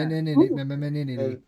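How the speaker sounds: Anemone babbled slowly, syllables looping; stretched word. Pretend. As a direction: drag Anemone out into repeated syllables.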